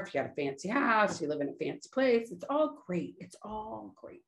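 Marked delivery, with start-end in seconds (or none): 3.2-4.2 soft echo. Shown as a demonstration. Repeats phrase, second take hushed.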